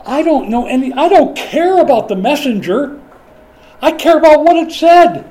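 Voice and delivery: loud, high, rising insistence; emphatic preaching. Real, not acted. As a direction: drive the point home to the congregation.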